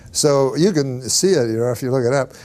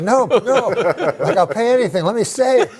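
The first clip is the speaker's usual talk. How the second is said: desperate pleading; 9 semitones higher than usual. Retold or imitated. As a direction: plead frantically, voice up high. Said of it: "I'm like".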